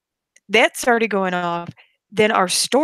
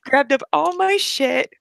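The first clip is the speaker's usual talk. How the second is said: ordinary delivery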